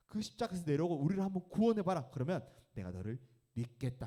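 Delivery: loud, high jeering taunt at Jesus; mocking robber. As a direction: sneer it up at the man on the cross. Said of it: "이 두 강도는 ... 이렇게 얘기한 거야". (십자가의 예수를 조롱하는 강도, 높고 큰 비웃음)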